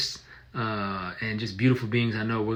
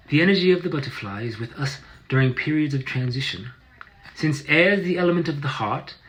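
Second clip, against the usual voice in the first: higher-pitched British accent reading; 4 semitones higher, 3 dB louder than usual. Shown as a demonstration. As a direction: read it out in a put-on British accent. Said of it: "I'll read it in my British accent".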